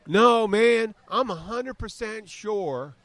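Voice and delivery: high, loud southern drawl, slow on "hundred percent sure"; exaggerated southern twang, insisting; put-on southern accent. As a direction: play him as a cocky Southern good old boy. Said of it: "I don't think he had a southern accent".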